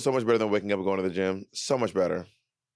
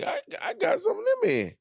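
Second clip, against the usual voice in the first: laughing squeal. Retold or imitated. Mock-energized gym quote, laughing.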